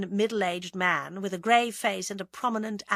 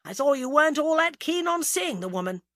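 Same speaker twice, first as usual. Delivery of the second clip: higher, louder complaint; plaintive; 8 semitones higher, 5 dB louder than usual. A narrator's reading. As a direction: protest plaintively to someone hurrying off. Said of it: "demanded James plaintively".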